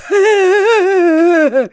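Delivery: high, loud forced laugh; loud fake laugh. Rainer mocks Death with a loud fake laugh. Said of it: "ließ ein lautes Fake-Lachen hören".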